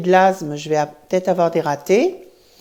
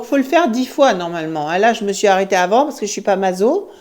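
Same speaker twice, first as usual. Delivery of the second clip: ordinary talk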